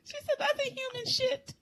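very high, squeaky laughing retelling; words squeezed through helpless laughter; laughing hard. Real, not acted. Retelling grandmother's verdict to co-host, laughing hard.

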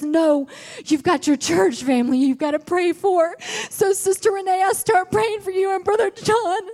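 high, loud, crying testimony; building revival preaching; preaching at full force. Acted. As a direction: preach it, voice breaking with feeling.

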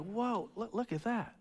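whispered wonder; mock awe, very soft; hushed amazement. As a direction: breathe it out softly in awe. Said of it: "and you're like".

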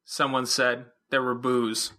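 ordinary delivery, nothing marked

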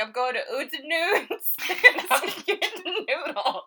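giggling mock exit; laughing through words. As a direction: crack up while saying it. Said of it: "I'm like".

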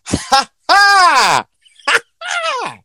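high, loud mock triumphant cackle; hammed-up victory laugh; gloating laugh. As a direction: crow over the genie like a winner. Gloats after tricking the genie.

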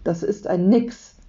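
usual speaking voice

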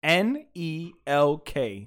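slow, separate letters; spelling out slowly. Real, not acted. Host telling listeners what group to find, slowly spelled.

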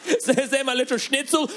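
put-on high, loud cooing old German lady. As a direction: coo at him like a doting grandmother. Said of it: "She, she's like".

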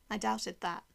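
usual speaking voice

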